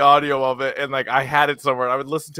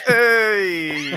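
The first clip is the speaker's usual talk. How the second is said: mock Joe Pesci hey; 6 semitones higher than usual. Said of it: "And then he goes".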